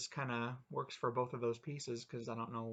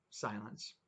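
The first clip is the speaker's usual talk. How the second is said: usual speaking voice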